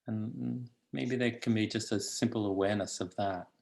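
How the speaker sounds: calm, unhurried guidance with long pauses; guided meditation. Acted. Teacher settling meditators into the body, calm guided meditation. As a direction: lead them gently into stillness.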